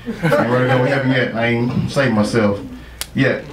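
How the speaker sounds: normal talking voice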